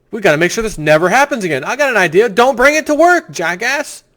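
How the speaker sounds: fast, high, loud sarcastic jab; mock the obvious solution with a sneer; sarcastic mockery. Real, not acted. Host sneering at the governor, loud and sarcastic.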